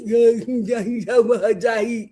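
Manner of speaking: wordless mumbled imitation of his speech; garbled nonsense mimicry; mocking garbled throat talk. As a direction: babble in the throat, mocking how he talks. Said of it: "talking like that".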